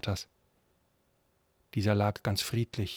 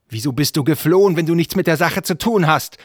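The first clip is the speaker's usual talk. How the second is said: loud, raised-pitch challenge; angry interrogation; 7 semitones higher, 10 dB louder than usual. Acted. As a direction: demand an answer from the suspect. Prince interrogates the suspect. (lautes, zorniges Verhör)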